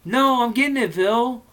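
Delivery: high, loud called-out reply; calling out. Acted. Georgie calling up to Bill, high and loud. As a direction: shout it back from downstairs. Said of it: "Georgie called out at once".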